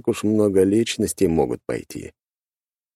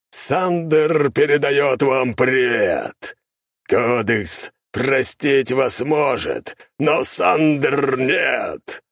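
inhuman, slow, booming voice; eldritch horror; 7 semitones higher, much slower than usual. A narrator's reading. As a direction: inhuman demon taunting slowly. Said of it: "раздался нечеловеческий голос".

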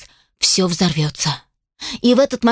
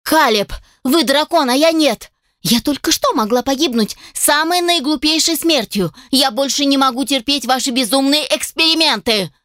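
put-on higher, indignant young woman; angry elf girl; 5 semitones higher than usual. Elf assistant scolds the dragon inventor, higher indignant voice. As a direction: scold him with hurt pride.

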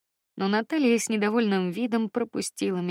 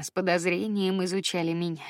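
ordinary delivery, nothing marked

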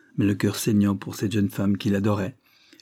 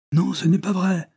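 quick denial; 9 semitones higher, 4 dB louder than usual. A narrator's reading. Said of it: "fit-il rapidement".

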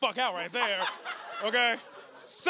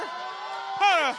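sharp, loud hold up; loud interruption; 8 semitones higher, 4 dB louder than usual. Real, not acted. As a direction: shout to cut them off. Cutting off opponent loudly.

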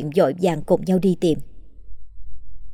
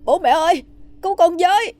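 much higher, terrified scream for help; 12 semitones higher than usual. A narrator's reading. Boy pinned by the ghost screams to his parents, terrified. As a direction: shriek it with every ounce of strength. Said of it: "dùng sức hét toáng lên".

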